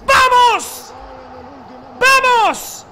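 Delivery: loud repeated cheers; celebratory shouting. Real, not acted. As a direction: celebrate at full voice. Goal celebration. (celebrando a gritos)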